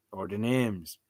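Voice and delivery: normal talking voice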